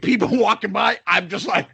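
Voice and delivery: usual speaking voice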